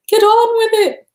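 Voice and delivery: high, loud shouted demand; Monty Python. Retold or imitated. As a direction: bellow it like an impatient king. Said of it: "In the immortal words of Monty Python".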